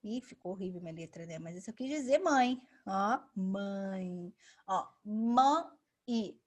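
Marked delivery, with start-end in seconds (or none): 4.7-6.4 mãe with a nasal ending that glides into i; demonstrating mãe. Shown as a demonstration. Teacher showing students how mãe sounds. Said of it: "a gente fala mãe".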